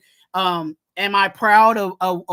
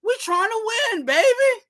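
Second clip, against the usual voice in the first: fired-up plea; 11 semitones higher than usual. Real, not acted. Ranting at a linebacker.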